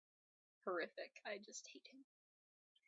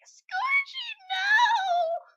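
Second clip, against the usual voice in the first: high, very loud anguished cry; anguished scream; 13 dB louder, much slower than usual. Retold or imitated. Viewer screaming as Scourge's kit is hurt, anguished cry. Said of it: "Exactly that voice".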